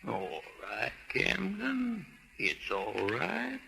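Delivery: calm her down gently; soothing. Acted. Uncle soothes upset niece.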